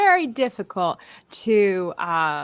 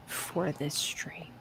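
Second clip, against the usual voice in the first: teasing stage whisper; 4 semitones lower, 12 dB quieter, much slower than usual. Acted. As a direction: whisper it as a tease. Teasing aside about cohost.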